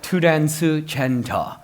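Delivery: slow Korean-accented "Tudensu Chento". Shown as a demonstration. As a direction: sound out transgender in a Korean accent. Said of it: "just said in a Korean accent".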